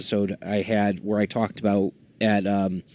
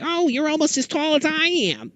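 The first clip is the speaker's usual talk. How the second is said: much higher, friendly cartoon voice; Barry Gordon voice. Dad imitating Barry Gordon joking with his wife, higher voice. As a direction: tease her playfully in his voice. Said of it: "He's like".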